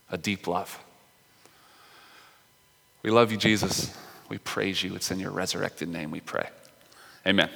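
soft, hushed prayer voice; a soft pastoral prayer; quiet prayer. Acted. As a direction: pray gently over the room. Pastor prays before the sermon.

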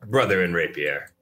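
usual speaking voice

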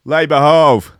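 hammed-up Italian American tough guy; Italian American accent. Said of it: "he might be American Italian".

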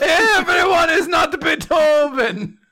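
high, slow Italian wail; shouted cartoon-Italian outrage; mock Italian accent. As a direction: proclaim it in hammed-up Italian. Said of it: "if you still have the accent".

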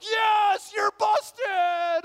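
high, loud gloating yell; gleeful shout. Retold or imitated. Friends in the back seat crowing at the busted driver, loud and gleeful. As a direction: crow at him like he just got caught. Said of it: "the guys are like".

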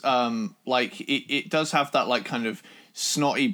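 ordinary talk, nothing marked

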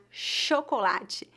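"chocolate" slowly, opening with sh. Shown as a demonstration. Teacher showing learners the CH digraph, CH as SH. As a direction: say "chocolate" as sho-ko-LA-chee. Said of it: "exatamente como as letras S H".

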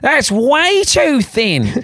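high, drawn-out comic Australian voice; Australian know-all, stretched out and pitched high; put-on Australian accent. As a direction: pass judgement slowly in exaggerated Australian. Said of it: "whenever I do an accent, I tend to, it always goes Australian".

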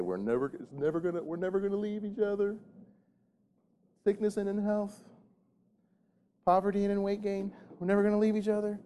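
high, held-pitch recited vow; mock vow in a high monotone; mock starry-eyed couple. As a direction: chant it high like naive newlyweds. Voices couples reciting their wedding vows.

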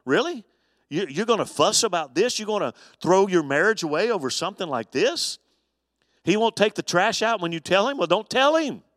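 a mock-exasperated counselor asking if this is really the fight; half-playful disbelief, voice high; incredulous. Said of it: "and you're like".

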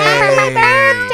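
high, loud, drawn-out shout; birthday cheer. Real, not acted. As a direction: cheer it at him.

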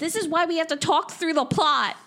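high, loud exasperated outburst; exasperated shout. Real, not acted. Scolding a co-host who missed the plot, exasperated. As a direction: raise your voice in comic exasperation.

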